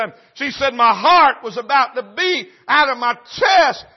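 high, loud cry building to a shout; preacher shouting the story's peak; shouted preaching. Acted. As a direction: raise the roof on the climax. Grandmother's climax, preached loud.

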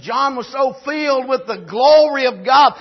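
loud, high preacher's shout; preaching at full force. Acted. Pastor condemning the world's mentality, loud preaching. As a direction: hammer the point home to the church.

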